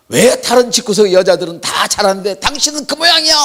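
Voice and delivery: loud, high mock scolding of a wife; a husband nagging his wife, mock angry and loud; mock nagging complaint. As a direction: bark it out like a fed-up husband. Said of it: "이렇게 말씀하시면 안 돼요".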